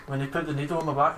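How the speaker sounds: usual speaking voice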